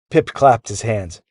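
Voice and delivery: ordinary delivery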